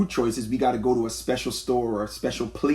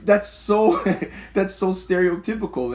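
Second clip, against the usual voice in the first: laughing through the words, pitched up; 6 semitones higher than usual. Real, not acted. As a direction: laugh while calling it out. Laughing at the example.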